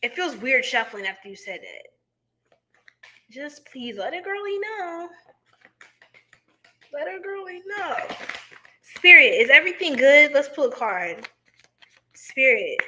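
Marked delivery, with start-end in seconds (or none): 3.3-8.0 high, soft pleading; gentle plea. Real, not acted. Asking a viewer to check in, a soft high plea. 8.8-10.3 calling out. Pretend. Pulling a tarot card, a loud call to spirit.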